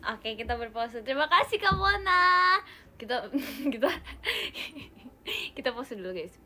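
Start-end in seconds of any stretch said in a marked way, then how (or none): 1.0-2.6 teriakan terima kasih. Real, not acted. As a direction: yell thanks and hold the name. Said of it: "jadi kita teriakin gitu". (teriak nyaring, namanya dipanjangkan)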